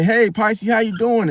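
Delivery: high, bright, chummy hello; friendly neighbor greeting. Retold or imitated. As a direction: call out to a neighbor like an old buddy. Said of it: "the neighbor say".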